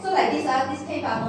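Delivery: ordinary talk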